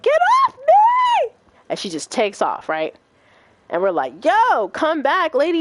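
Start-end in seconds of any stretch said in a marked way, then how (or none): 0.0-1.3 drawn-out, very high, louder mock shriek; imitated scream. Storyteller as the drunk girl fighting people off, shrieking. 4.2-5.6 mild mock holler, pitched up and stretched out; calling after someone. Storyteller calling after the girl who takes off, mock holler.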